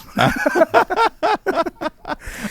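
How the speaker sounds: very high-pitched giggling laugh; laughter. Real, not acted. Laughs at euphemism for strippers.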